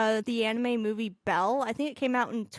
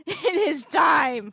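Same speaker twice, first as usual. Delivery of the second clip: shouted; 3 semitones higher, 4 dB louder, slower than usual. Real, not acted. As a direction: yell it out like a battle cry. Hyped reaction to epic scene.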